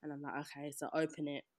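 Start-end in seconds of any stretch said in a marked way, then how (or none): 0.4-0.7 flat resigned okay. Retold or imitated. Unwrapping box after box.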